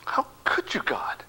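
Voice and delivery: high, breathless whispered plea; anguished whisper. Retold or imitated. Grieving father whispering his anguish to God. Retold raging prayer at God.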